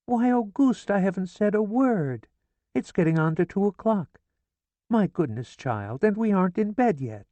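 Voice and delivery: high, fussy mother voice; a mother fretting aloud in a high voice; chatty mother, pitched high; mother's voice. A narrator's reading. Mother protests to husband.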